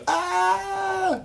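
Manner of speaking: high-pitched shriek of screaming fans; fangirl scream. Retold or imitated. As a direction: shriek like a screaming teen fan. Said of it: "des tas de pisseuses en train de crier".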